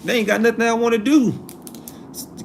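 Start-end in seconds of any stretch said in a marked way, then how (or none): 0.0-1.3 put-on high-pitched complaint of a reluctant kid; dismissive student. Student reacting to a pushed college choice, dismissive complaint. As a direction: push the pitch up, balking like a student. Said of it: "That's how it comes off".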